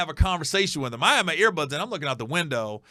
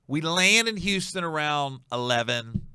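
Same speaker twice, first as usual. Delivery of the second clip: ordinary delivery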